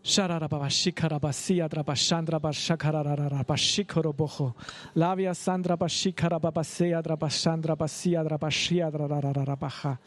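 fast flowing tongues prayer; speaking in tongues. Real, not acted. Pastor leads the church in tongues prayer, fervent flowing. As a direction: pour out syllables in prayer.

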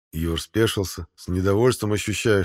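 ordinary delivery, nothing marked

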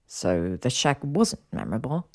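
low male voice, affronted question; indignant. Acted. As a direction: ask it as if wounded in pride. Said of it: "His tone was indignant".

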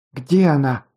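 mildly exasperated cry; understated angry outburst from a girl; heated outcry. A narrator's reading. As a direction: cry it out in a flash of temper, kept in check. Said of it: "в сердцах выкрикнула девушка".

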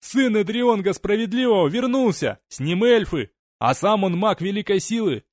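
desperate shout. Acted. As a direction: scream the truth from the gallows. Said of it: "он закричал".

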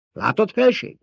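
high-pitched indignant outburst. A narrator's reading. Baron bursts out to his emperor, agitated. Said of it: "Fakadt ki a báró".

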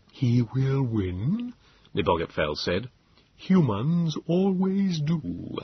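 very slow, alien, measured Morlock; cold certainty from an ancient creature. A narrator's reading. Morlock Nebogipfel voiced in story.

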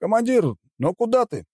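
high-pitched, indignant question; indignant protest. A narrator's reading. Soldier protests as his commander leaves, indignant. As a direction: object to the commander leaving. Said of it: "возмутился кто-то из бойцов".

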